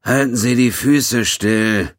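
put-on high, slow, loud grumpy old man. A narrator's reading. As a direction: snap like a cranky old man woken up. Grumpy old doctor woken at night.

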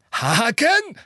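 high, loud gleeful echo; voice shaking with glee. A narrator's reading. Minister gleefully echoes the headmaster, high and loud. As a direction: toss the word back in delight. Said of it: "Stimme vibrierte immer noch vor Freude".

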